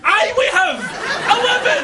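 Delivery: high, exasperated comeback; irate retort. Acted. Trapped passenger answering the lift, irate retort. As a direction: shout back at the machine, pitch climbing.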